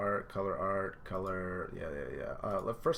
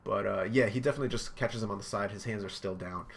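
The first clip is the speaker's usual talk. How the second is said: ordinary talk, nothing marked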